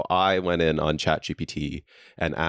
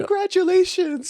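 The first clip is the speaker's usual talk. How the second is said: mock ad voice; 4 dB louder than usual. Mimicking a celebratory coffee ad.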